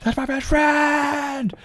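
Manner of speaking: very high, drawn-out squeal; mock squeal. Streamer acting a fan about a best friend, high mock squeal. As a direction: squeal it slowly, stretching the last word.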